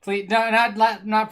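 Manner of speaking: loud high begging; loud pleading. Real, not acted. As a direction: beg loudly, voice jumping up. Begging during card game loss.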